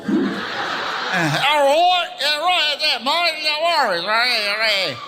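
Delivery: high, slurry imitation Aussie; hammed-up drunk Australian accent; drunken Australian accent. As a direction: slur it like a drunk mimicking Australians. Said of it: "I got so drunk, I sounded like them".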